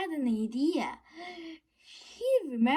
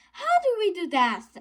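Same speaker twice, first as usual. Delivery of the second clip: fast, high, louder yell; 7 semitones higher, 7 dB louder, faster than usual. A narrator's reading. Biff yelling at the others for help. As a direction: call it out quickly in alarm. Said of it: "yelled Biff".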